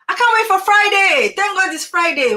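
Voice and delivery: high, loud, gushing relief at the week's end; mocking Friday excitement. Speaker mimics weekday workers to her class, mock excited. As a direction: play the worker who only lives for the weekend. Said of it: "You know that thing that you people do?".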